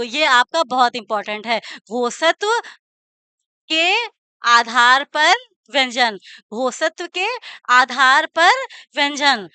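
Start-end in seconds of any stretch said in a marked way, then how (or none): 1.9-6.2 phrase broken up with long pauses; slow dictation. Shown as a demonstration. Teacher dictates phrase piece by piece. 6.5-9.5 short pieces with pauses between; dictation. Shown as a demonstration. Teacher repeats dictation in pieces.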